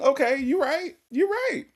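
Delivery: exaggerated high-voiced giving in; mock concession. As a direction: squeak out a mock admission, voice way up high.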